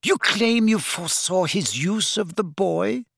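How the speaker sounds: high, mocking question; jeering. A narrator's reading. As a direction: taunt with disbelief. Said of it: "she jeered".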